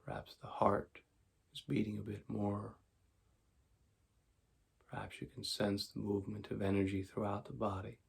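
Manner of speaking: calm, unhurried meditation voice with long pauses; guided meditation. Acted. Teacher guiding tension and relaxation before sleep, calm meditation voice. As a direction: talk them softly into letting go.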